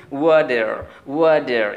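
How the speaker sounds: ওয়াটার as loud "wa-der", r sounded; American t as d. Shown as a demonstration. Teacher demonstrating American water, ওয়াটার as "wader". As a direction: turn the t of ওয়াটার into a d. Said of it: "ট সাউন্ডকে অনেকটা ড করে দেয়".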